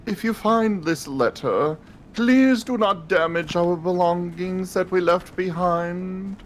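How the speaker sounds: lightly posh reading of the letter. Acted. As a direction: read it like a haughty aristocrat. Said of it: "I love that posh voice".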